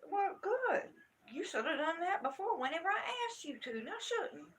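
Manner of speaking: high, loud scolding retort; retold angry comeback. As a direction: throw the threat back at them indignantly. Retold argument about calling security.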